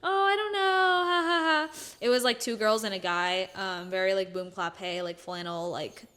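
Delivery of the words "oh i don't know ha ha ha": high, nervous fake laugh through the words; awkward laughing reply. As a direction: imitate her nervous dodge with a forced ha ha.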